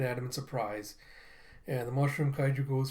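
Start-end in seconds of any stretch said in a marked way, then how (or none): none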